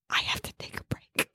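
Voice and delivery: breathless whispered plea. Retold or imitated. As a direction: whisper it, desperate and pleading. Queen desperate for a break.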